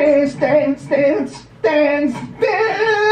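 very high, excited chant; high-pitched cries. Pretend. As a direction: cry the word out high, over and over. Calling out rhythmically in a performance.

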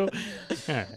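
ordinary talk

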